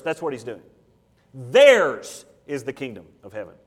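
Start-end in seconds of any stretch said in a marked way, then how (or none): 1.3-3.5 "Theirs" punched loud and long, then easing off; slow line, underline "Theirs" with the voice; stress on THEIRS. Shown as a demonstration. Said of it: "it is an emphatic pronoun".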